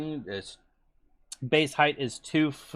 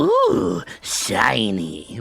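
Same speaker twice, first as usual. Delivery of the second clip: goblin voice; much slower than usual. Retold or imitated. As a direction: squeal it like a goblin spotting treasure. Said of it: "doing the goblin voice".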